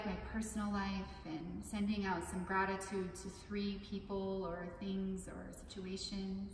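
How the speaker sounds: calm, measured meditation guide with long pauses; unhurried meditation instruction, spaced out phrase by phrase; guided meditation. Acted. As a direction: lead listeners gently through it, leaving room to breathe.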